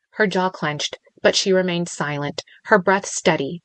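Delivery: ordinary delivery, nothing marked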